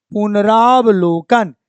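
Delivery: पुनरावलोकन said slowly with each syllable clear; slow, careful पुनरावलोकन for students to repeat; slow model word. Shown as a demonstration. Teacher telling students exactly what to say. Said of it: "ये बोलिएगा".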